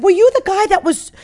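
keen to confront him, lifted voice; eager question. Retold or imitated. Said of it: "I said".

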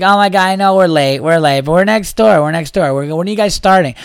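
put-on high, loud, gushing party-girl voice; loud drunk woman. Late bar woman bursting into the comedy room, loud and high. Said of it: "She was loud".

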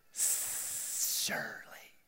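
long, slow, hissed "Surely." with no voice; the serpent hissing "Surely." at Eve. Shown as a demonstration. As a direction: stretch "Surely." into a snake's whispered hiss. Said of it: "As the hiss of the serpent".

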